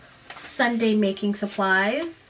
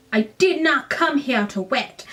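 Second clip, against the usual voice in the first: attempted British accent, higher pitched; badly faked British accent on a line of dialogue; 4 semitones higher, 4 dB louder than usual. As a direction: have a clumsy go at sounding British. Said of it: "I can't do a British accent".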